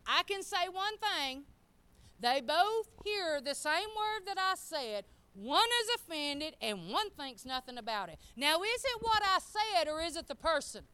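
forceful sermon delivery; emphatic preaching. Real, not acted. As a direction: drive the point home loudly and high. Preaches with raised voice.

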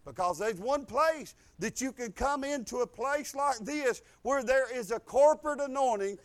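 ordinary delivery

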